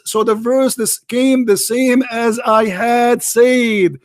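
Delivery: every word slow and weighted; slow emphatic reading. Real, not acted. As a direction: lay out "came the same" slowly, word by word.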